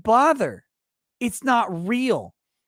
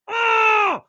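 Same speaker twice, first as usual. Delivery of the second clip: high, strangled cry of rage; frustrated shout. Acted. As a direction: blow off steam with an ugh. End of the rant.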